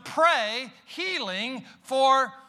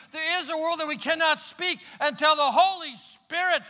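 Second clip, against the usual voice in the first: raised, driving sermon delivery, higher pitch; full-force preaching; 6 semitones higher than usual. Acted. Preacher urging the congregation to hear the Spirit, full-force preaching. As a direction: preach it out with rising fervor.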